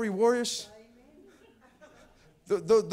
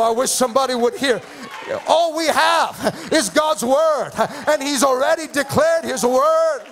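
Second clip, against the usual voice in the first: higher, driving sermon delivery; impassioned pulpit proclamation; full-force preaching; 5 semitones higher, 4 dB louder than usual. Real, not acted. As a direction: preach it with rising urgency, stretching the key phrases.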